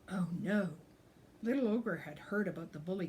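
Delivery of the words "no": ordinary delivery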